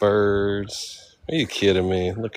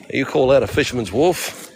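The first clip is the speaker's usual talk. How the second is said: higher mock-Australian challenge; badly faked Australian accent, lilting upward; 4 semitones higher than usual. Shown as a demonstration. Tourist testing how locals say it, mock Australian accent. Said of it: "how do you say that in Australian accent?".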